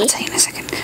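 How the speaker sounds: breathy whispered aside. Real, not acted. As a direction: promise it under your breath.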